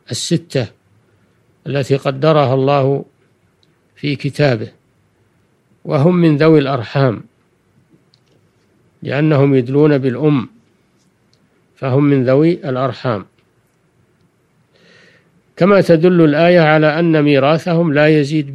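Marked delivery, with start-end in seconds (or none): none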